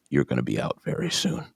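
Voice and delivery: soft, understated mock threat; quiet warning. Dad warns his jobless son, quietly ominous. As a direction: drop it to a quiet imitation of Dad. Voicing dad's warning look.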